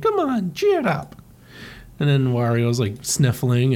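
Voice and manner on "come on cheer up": high cartoon Mario coaxing; Mario's voice. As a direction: coax him up high.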